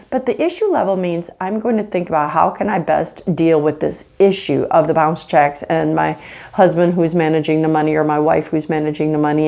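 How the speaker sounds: ordinary talk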